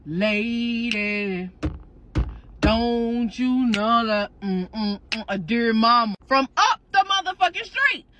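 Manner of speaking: drawn-out churchy auntie call. Acted. Auntie calls out at church stairs.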